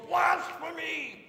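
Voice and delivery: high priest faking shock, yelling blasphemy; outraged yell. Acted. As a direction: shriek it as feigned outrage, slowing down. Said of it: "and he yells".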